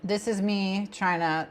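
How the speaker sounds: ordinary delivery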